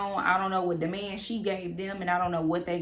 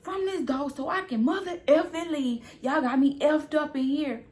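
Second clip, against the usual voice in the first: angry demand; 7 semitones higher than usual. Retold or imitated. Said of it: "I'm telling him".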